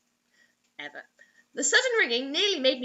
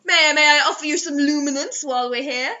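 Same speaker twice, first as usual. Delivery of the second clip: mock gentleman; 3 semitones higher, 8 dB louder than usual. Voicing a street lamp.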